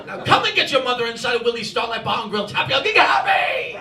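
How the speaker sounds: bossy mother in a mock English accent. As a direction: order them in an English accent, shouting the end. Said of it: "she does it all in an English accent".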